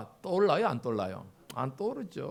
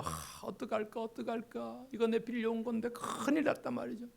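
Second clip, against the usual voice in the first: mock crying student; 6 semitones higher, 3 dB quieter than usual. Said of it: "울고 있단 말이에요".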